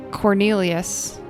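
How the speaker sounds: ordinary talk, nothing marked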